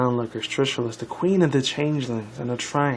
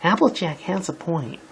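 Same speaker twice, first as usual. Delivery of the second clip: higher-pitched pony heroine voice; put-on higher character voice; Twilight; 6 semitones higher than usual. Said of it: "Twilight, Twilight started".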